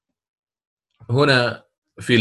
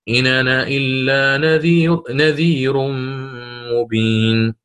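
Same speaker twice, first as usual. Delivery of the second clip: Warsh recitation; 2 semitones higher, slower than usual. Shown as a demonstration. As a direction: chant the verse in Warsh's reading. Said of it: "الإمام ورش". (تلاوة برواية ورش)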